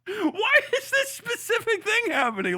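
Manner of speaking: high, laughing disbelief; baffled and half laughing; incredulous high. Real, not acted. Baffled at his feed.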